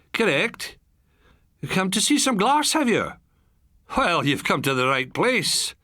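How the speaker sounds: a friendly Highland farmer, lightly voiced; light Highland accent. A narrator's reading. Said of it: "traces of the pure Highland accent".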